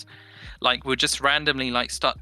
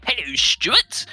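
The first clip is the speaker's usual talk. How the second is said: drawn-out, loud, high weird voice; weird greeting; 7 semitones higher, 5 dB louder, much slower than usual. Retold or imitated. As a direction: stretch the greeting out oddly and loudly. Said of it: "So we started to talk, like, really weirdly".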